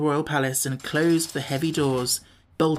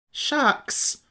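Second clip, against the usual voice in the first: indignant; 11 semitones higher than usual. A narrator's reading. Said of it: "returned Tip indignantly".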